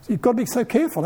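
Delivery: ordinary talk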